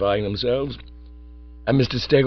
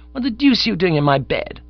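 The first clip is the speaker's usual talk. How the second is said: outraged surprise; 10 semitones higher, 5 dB louder than usual. Acted. Bertie finds Bingo in his bed.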